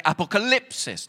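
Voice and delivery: Apocalypsis with the stress on LIP; Apocalypsis, leaning on the third syllable, "lip". Shown as a demonstration. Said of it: "the emphasis is on the lip".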